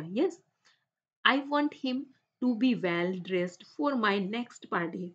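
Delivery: slow, careful read-aloud for the class. Shown as a demonstration. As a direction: read each word out slowly and clearly. Teacher reads English text aloud.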